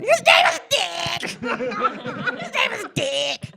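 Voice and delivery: high, loud, slow shout breaking into laughter; inner shriek. Retold or imitated. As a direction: scream it inside your head, delighted.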